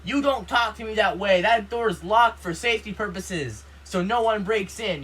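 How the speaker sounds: imitated angry mom telling someone off; mom yelling back. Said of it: "my mom freaking screamed back at him".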